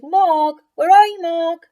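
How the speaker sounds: loud high calls; children calling for Mog. Acted. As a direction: call into the dark garden. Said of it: "They shouted".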